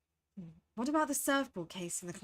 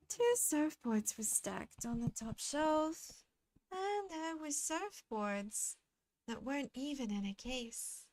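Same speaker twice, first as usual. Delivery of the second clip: slow, soft character voice trailing away; soft-spoken game character; 4 semitones higher, 5 dB quieter than usual. Acted. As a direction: read it slowly and gently, as a musing character. Reads game character's lines.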